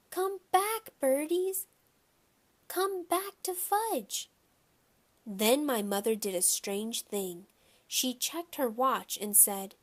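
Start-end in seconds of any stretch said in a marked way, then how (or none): 0.1-4.3 put-on high, slow little-kid wail; toddler screaming. A narrator's reading. Toddler screaming at the pigeons, high slow wail. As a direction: wail after the birds like a small child. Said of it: "was screaming".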